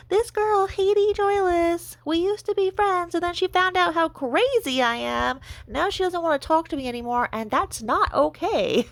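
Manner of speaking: high-pitched Irish Traveller accent imitation. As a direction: mimic her in a put-on Traveller accent, high and whiny.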